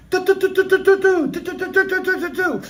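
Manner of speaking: high, rapid-fire volleys of syllables; imitated gunfire. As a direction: rattle off bursts of machine-gun noise. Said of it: "Alls I can hear is".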